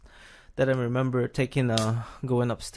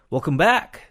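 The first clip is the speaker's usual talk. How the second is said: higher, louder, booming radio host; radio announcer voice; 4 semitones higher, 10 dB louder than usual. Retold or imitated. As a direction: go big like a DJ coming back from a break. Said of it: "more like a radio guy".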